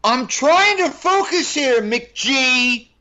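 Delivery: high, loud, drawn-out angry yell; full-voiced tantrum impression; Bale yelling. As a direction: blow up at the director, loud and high. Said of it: "do the impression of Bale yelling at him".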